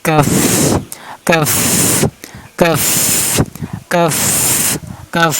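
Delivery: কাফ ending in a long puff of air; breathy final F. Shown as a demonstration. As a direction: let the breath hiss out on the end of কাফ. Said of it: "ফার মতন করে লাস্টে বাতাস বের করে".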